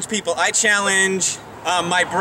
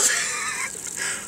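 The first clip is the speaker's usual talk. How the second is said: shocked laugh; 9 dB quieter than usual. Real, not acted. Ice water just poured over him.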